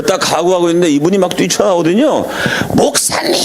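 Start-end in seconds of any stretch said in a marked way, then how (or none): 2.8-3.3 much higher pitched mock cry of pastor; retold shout. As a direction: call out the pastor high, like an old man bursting forward. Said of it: "하면서 소리를 질러요".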